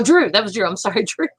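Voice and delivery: ordinary talk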